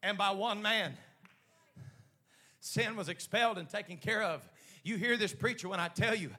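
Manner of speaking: building from firm, loud preaching to a full-force shout; rising pulpit crescendo. Real, not acted.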